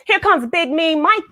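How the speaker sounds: high excited dog voice; a touch of a cheering pack; dogs calling out. A narrator's reading. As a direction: announce him like a gang of dogs. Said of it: "the other dogs would say".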